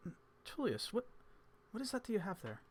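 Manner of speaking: an intrigued scholar asking about the gem; Evans voice. Acted.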